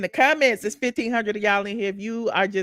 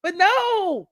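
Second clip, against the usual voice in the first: raised, higher, near-shouting complaint; real anger; 7 semitones higher than usual. Real, not acted. Vlogger venting at the officer, real angry raised voice. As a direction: let the outrage break through. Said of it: "I'm still pissed off".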